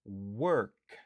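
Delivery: correcting walk to Work; work not walk. Shown as a demonstration. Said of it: "Se pa walk, se work".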